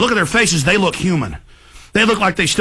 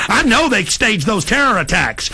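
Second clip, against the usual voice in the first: normal talking voice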